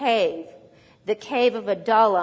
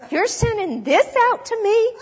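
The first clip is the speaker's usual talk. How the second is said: outraged, high and loud; mad Goliath; 11 semitones higher, 6 dB louder than usual. Retold or imitated. Goliath taking one look at David, mad and insulted. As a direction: bellow it in offended disbelief. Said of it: "he was actually mad".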